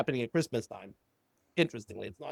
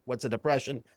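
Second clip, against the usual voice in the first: usual speaking voice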